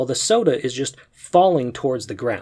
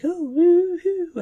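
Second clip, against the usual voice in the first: put-on much higher, slow baby coo; much slower than usual. Baby in his arms cooing, high and slow. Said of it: "like, cooing".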